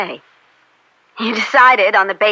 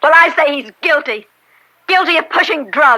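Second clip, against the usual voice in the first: higher, raised voice insisting; heated defiance; 6 semitones higher than usual. Acted. Daughter defying her judge father over the verdict, heated. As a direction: push back hard at him.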